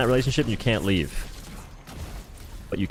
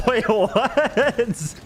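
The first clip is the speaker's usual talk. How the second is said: surprised laugh; 12 semitones higher than usual. Real, not acted. Stunned by friend's remark.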